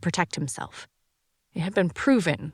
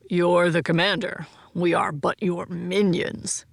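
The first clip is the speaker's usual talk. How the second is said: slow, grand, overdone pomp; pompous voice; slower than usual. Acted. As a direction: lay the pomp on thick. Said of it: "in an overly pompous voice".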